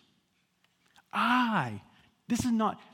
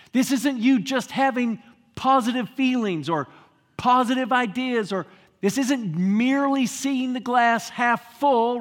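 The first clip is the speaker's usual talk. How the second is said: louder, a bit higher, slowing at the end; preaching at full force; 3 semitones higher, 6 dB louder than usual. Acted. Preacher declaring Christ's power to the church. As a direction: build to the climax.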